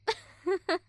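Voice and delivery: a quick, loud scornful laugh; brief cruel chuckle; short mocking laugh. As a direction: laugh them off. Bully laughs at the victim.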